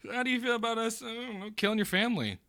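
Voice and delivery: high-pitched replay of the question; put-on high voice, teasing; mimicking echo. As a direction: repeat it back high and amused. Game master laughingly echoes player's question.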